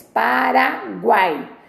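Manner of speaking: "Paraguai" slowly in three beats, pa-ra-guai; "Paraguai" stretched syllable by syllable; three separate syllables. Shown as a demonstration. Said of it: "ele é pronunciado por três vezes".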